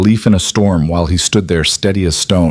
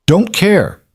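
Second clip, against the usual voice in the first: clipped higher cutoff, a touch of anger; sharp cutoff; 12 semitones higher than usual. A narrator's reading. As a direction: cut him off sharply. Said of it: "Noah cut him off".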